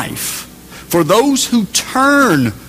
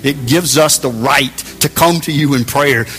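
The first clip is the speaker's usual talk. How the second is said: closing prayer; 4 semitones lower than usual. Acted. Pastor praying to God for the church, reverent and calm. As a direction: pray it over the congregation gently.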